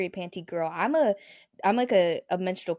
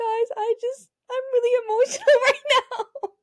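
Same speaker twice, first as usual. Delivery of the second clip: much higher, mock tearful whine with a laugh; mock teary plea; 5 dB louder than usual. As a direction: act like a kid about to cry at parents. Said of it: "and I'm like".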